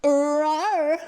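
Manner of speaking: high roaring monster growl; comic creature roar; Rancor roar impression. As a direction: roar like a huge slobbering beast. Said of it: "That was my impression of a Rancor".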